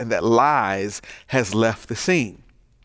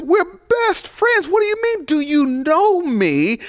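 outraged. Acted. Truth confronts Lies again.

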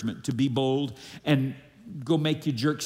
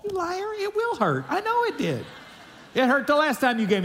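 hammed-up squeal, much higher, ends in a laugh; mock indignation; 11 semitones higher than usual. Patient joking about a nurse who said it wouldn't hurt, in mock outrage. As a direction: play it as comic outrage at the nurse.

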